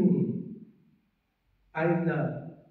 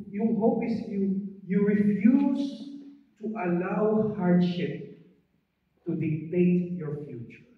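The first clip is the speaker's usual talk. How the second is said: slow, deliberate preaching; slow, weighty sermon line; preaching emphasis; 5 semitones higher, 3 dB louder, much slower than usual. Real, not acted. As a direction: spell out the lesson slowly.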